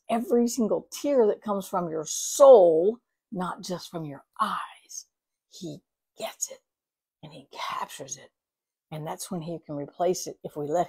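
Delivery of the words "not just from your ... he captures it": hushed, breathy whisper on God's care; soft confiding whisper. Real, not acted. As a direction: drop to a near whisper, intimate. Pastor softens on God's care.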